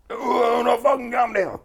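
high, loud enraged yell; furious mock shout. Angry father yelling at the crowd while pinned, raging. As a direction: howl it like a raging dad. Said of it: "you go".

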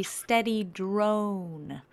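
ordinary delivery

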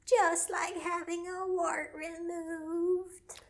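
high, slow, drawn-out voice; imitated obscene caller, high-pitched and slow; creepy caller's voice. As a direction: stretch it out as the weird caller. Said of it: "And then the voice goes".

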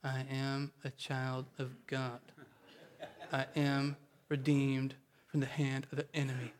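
half-hearted, quiet droning; lifeless recitation. Shown as a demonstration. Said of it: "please don't say it like this".

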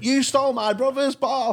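put-on high-pitched little kid accusation; squeaky mock child voice; four-year-old boy. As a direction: imitate an indignant small child. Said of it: "he was only about four".

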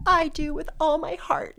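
a hint of a crying mom giving her daughter away; tearful mom. Mother answering the pastor, mildly voiced through tears. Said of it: "She's like".

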